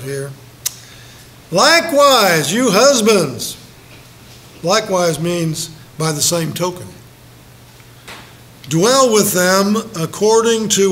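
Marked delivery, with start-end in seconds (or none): 1.5-3.6 slow, loud, lifted scripture read. Acted. Preacher reading the verse to husbands, slow and loud. 8.7-10.9 slow, louder scripture read. Acted. Preacher quoting the verse to the church, slow.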